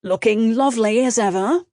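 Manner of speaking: smarmy British compliment; British charmer, rising at the end; British accent. A narrator's reading. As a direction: flatter her in a British accent. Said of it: "now in a British accent".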